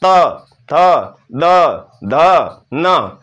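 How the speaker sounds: त थ द ध न spoken one by one, tongue against the front teeth; slow demo of त, थ, द, ध, न; dental series demo. Shown as a demonstration. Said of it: "त वर्ग का उच्चारण स्थान दंता".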